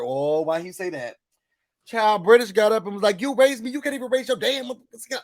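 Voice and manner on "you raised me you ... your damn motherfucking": high, fast mock outburst; angry comeback. Recapper acting out British going off, angry comeback. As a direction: fire back indignantly as British, imitated.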